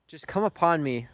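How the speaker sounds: loud, pleading cry to God; a desperate plea, voice lifted; pleading prayer. Retold or imitated. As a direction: beg for it out loud. Retold prayer on his knees.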